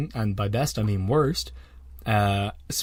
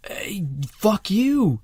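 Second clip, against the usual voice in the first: high-pitched, drawn-out mock outrage; indignant rebuke; 6 semitones higher, 4 dB louder, slower than usual. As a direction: tell them off, stretching the words high.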